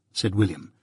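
ordinary delivery

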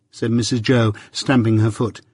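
plain reading voice

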